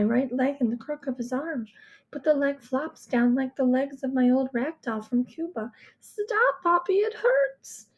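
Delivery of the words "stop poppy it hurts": high, slow, pleading cry of pain; a hurt little girl begging him to stop; child in pain. A narrator's reading. Girl begs her father to stop lifting her leg, high pained plea.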